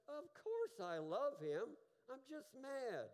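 slow, high, emphatic retort from the wife; exasperated retort. Retold or imitated. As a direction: drag it out, fed up.

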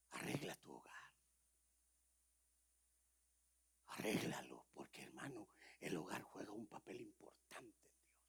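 breathy preacher's whisper. Acted. Preacher whispering to the congregation to fix their homes. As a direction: whisper it like a secret warning.

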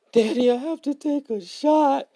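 put-on high little girl voice, whiny; child's voice. Dad imitating his daughter's worried high child voice.

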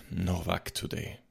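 softened grumbling murmur; grumpy whisper. A narrator's reading. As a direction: grumble it quietly to yourself. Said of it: "Ich flüsterte grummelig".

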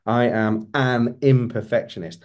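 slow down and land heavily on "an"; deliberate emphasis on the small word "an"; stress on AN. Shown as a demonstration. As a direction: punch "an" before "imperfectionist". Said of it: "emphasize each word differently as you say it".

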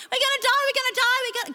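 high, frantic pretend panic; mock panicked screaming. Preacher mimics people panicking in life, mock panicked screaming. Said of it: "like, panicking and screaming in life".

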